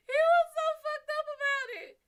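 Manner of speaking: high, loud laugh through the words; laughing hard. Real, not acted. To her viewers about her boyfriend's shock, laughing loudly. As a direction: crack up while telling it.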